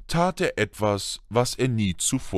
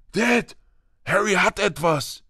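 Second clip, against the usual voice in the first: put-on high-pitched child's call; tattling boy; 9 semitones higher than usual. A narrator's reading. Son telling father Harry has something, high tattling voice. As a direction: tell on him to dad, voice pitched up.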